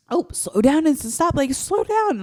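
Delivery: urgent mock warning that leaps up at the end; overreacting parent alarm; reactive, crazy. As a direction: imitate a panicking passenger, high-pitched. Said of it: "not as reactive, not as crazy".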